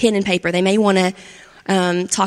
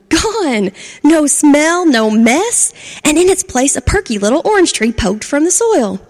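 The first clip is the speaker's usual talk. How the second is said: higher and louder burst of delight; excited exclamation; 6 semitones higher, 5 dB louder than usual. A narrator's reading. Narrator celebrating the vanished stinky tree, excited shout. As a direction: shout it out with glee.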